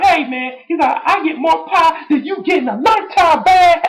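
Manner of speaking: high, loud cocky boast; The Rock impression. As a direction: brag it in a squeaky cartoon voice. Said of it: "he's just like".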